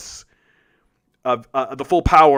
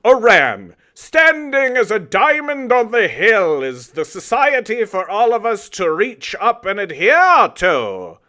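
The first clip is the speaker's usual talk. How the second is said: a lightly hammed-up lofty speech voice, higher; mock grandiose; 7 semitones higher, 6 dB louder, slower than usual. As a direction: parody the opponent's idealized claim, slow at first. Mocking an opponent's lofty claim.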